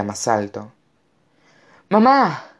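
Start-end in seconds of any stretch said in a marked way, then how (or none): 1.9-2.4 drawn, high, loud cry; desperate call. A narrator's reading. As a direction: shout for your mother in alarm. Boy calling mother in danger. (grito agudo llamando a mamá)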